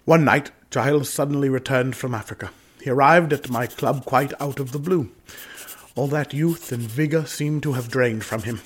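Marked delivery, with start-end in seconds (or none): none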